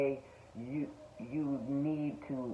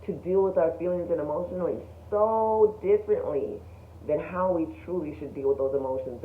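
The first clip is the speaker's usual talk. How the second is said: usual speaking voice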